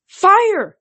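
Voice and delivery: high, loud command to shoot; shouted order. A narrator's reading. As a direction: bark the order to the archers. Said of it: "Zoe ordered".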